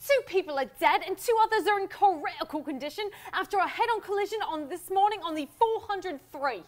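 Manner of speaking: high, loud put-on British accent; hammed-up British newsreader. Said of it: "I can do it in a British accent".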